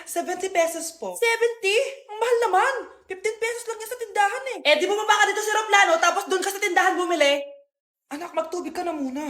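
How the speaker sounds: ordinary talk, nothing marked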